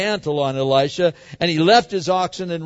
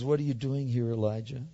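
much softer and lower, gentle calling; quiet voice of God; 4 semitones lower, 8 dB quieter than usual. Pretend. God gently calling Elijah out of the cave, softly and low. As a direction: call him softly, like a still small voice. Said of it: "the voice comes and says".

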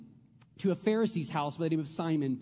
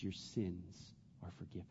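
gentle forgiveness; 10 semitones lower, 14 dB quieter than usual. Acted. Jesus speaking to the woman.

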